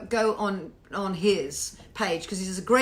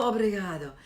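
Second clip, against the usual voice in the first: normal talking voice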